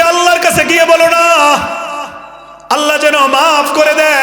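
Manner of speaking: high pleading cry; desperate shout. Acted. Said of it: "বেনামাজি চিৎকার করে ফেরেশতাকে বলবে". (জাহান্নামী ফেরেশতাকে চিৎকার করে অনুনয় করছে)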